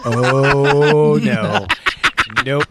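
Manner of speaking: long, loud, high "oh" with laughter; laughing protest. Real, not acted. Host refusing to edit that out, laughing. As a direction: draw out a big amused "oh no".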